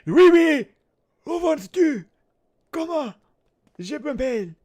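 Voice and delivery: high, out-of-breath French squeaks; French Voldemort. Acted. French villain trying to introduce himself, out of breath and squeaky. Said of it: "Just an out-of-breath French person".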